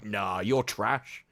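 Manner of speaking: low, slow put-down; mock blunt dismissal. Psychic answering a hopeful bar exam client, mock blunt dismissal. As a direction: drop low and drag out the dismissal.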